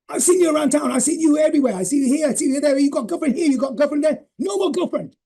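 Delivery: dad eagerly promising; dad giving up his girlfriends to win mum; imitating dad. Dad voiced making his promise.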